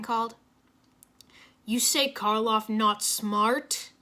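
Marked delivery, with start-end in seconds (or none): 1.6-3.9 insulted. A narrator's reading. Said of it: "clearly insulted".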